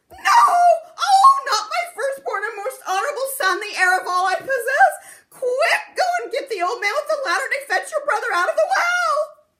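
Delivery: very high, very loud wailing alarm; a mother crying out in panic for her son; frantic mother's cry. Acted. Mother panicking about firstborn.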